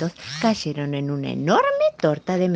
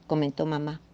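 ordinary delivery, nothing marked

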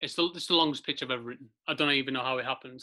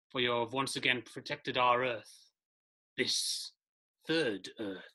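spirit voice; 3 semitones lower, 4 dB quieter than usual. Acted. Ancient spirits speak.

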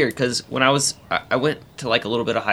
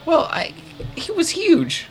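high squeaky voice; slower than usual. Retold or imitated. Describing huge guy, high voice.

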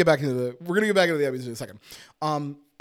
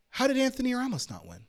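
ordinary talk, nothing marked

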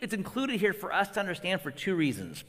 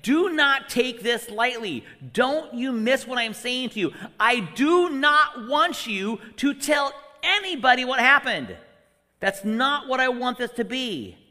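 louder, higher, slow and emphatic; intense warning; 5 semitones higher, 5 dB louder than usual. Pretend. Jesus sternly warns the leper, loud and slow. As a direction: drive it home with force. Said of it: "He wants the leper to understand".

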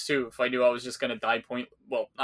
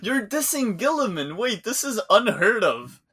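high, loud mock outrage; mock shock; 7 semitones higher, 3 dB louder, slower than usual. Real, not acted. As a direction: gasp it out in playful disbelief. Co-host shocked at diss.

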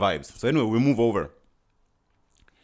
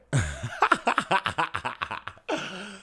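real laugh; 9 semitones higher than usual. Real, not acted. Laughing at the manager's reply.

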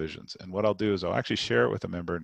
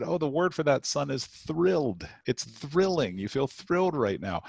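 gentle dad voice; 7 semitones higher than usual. Retold or imitated. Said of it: "I said".

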